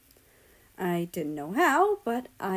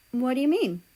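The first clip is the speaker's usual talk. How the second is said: confused; 5 semitones higher, 4 dB louder than usual. A narrator's reading. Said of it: "He sounded confused".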